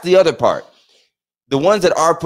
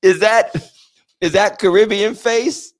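mock question; 8 semitones higher, slower than usual. Mock asking about Caribbean face.

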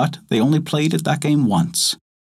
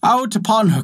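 mock outcry; 11 semitones higher than usual. A narrator's reading. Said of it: "cried the others".

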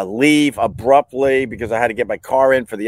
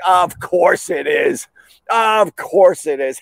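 slow, higher-pitched mock agreement; sarcastic resignation; 6 semitones higher, slower than usual. Customer hearing the priciest part is broken, sarcastic. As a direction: stretch it out, utterly unsurprised. Sarcastic reply to costly repair estimate.

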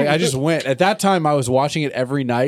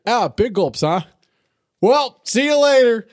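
high, drawn-out goofy farewell; goofy Jim Carrey movie voice; Lloyd impression; 9 semitones higher, slower than usual. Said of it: "I'd be Lloyd".

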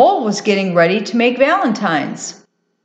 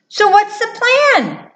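very high, eager mouse voice; curious little mouse; 13 semitones higher than usual. Acted. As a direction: ask keenly as a little mouse. Mouse wonders about the plan.

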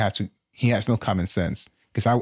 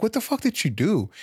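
high-pitched, incredulous question; retold alarmed demand; 11 semitones higher than usual. Dad questioning his kid on the phone, high incredulous demand. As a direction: act out a startled parent demanding answers.

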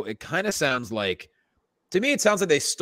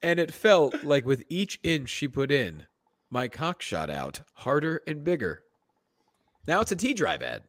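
dramatic reading; slower than usual. Acted. Dramatic reading with moaning finale.

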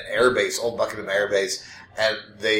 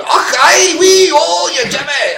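very high, loud mock-Scottish shouting; over-the-top fake Scottish yelling; terrible Scottish accent; 14 semitones higher, 6 dB louder, slower than usual. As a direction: holler in an absurdly bad Scottish accent. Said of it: "doing the fucking worst Scottish impression".

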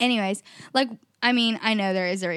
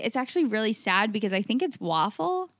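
normal talking voice

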